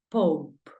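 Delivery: pope said with an open o, one short syllable; English pronunciation of pope. Shown as a demonstration. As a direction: model pope for the learner. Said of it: "papa, ¿cómo lo pronuncias?".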